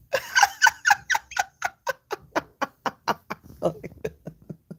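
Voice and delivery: long shrieking laugh; helpless high cackle; high cackling laugh. Real, not acted.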